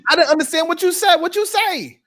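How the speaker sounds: high, loud incredulous retort; heated comeback. Acted. Answering a flat-earther, heated and high. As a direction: fire it back with pitch way up.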